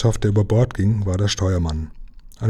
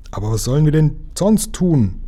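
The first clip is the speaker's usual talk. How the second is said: shout over the noise; 6 semitones higher than usual. Acted. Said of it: "brüllte der Kapitän".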